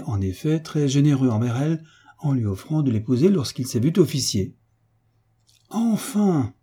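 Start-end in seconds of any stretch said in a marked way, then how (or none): 5.7-6.5 a high, ringing at-last; triumphant cry. Acted. The baron finally understanding, high triumphant cry. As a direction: crow it out in triumph. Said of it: "s'écria le baron, triomphant".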